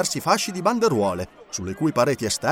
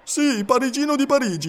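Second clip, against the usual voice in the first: higher, chest-out answer; proud Parisian; 11 semitones higher than usual. A narrator's reading. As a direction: say it with pride. Passepartout proud.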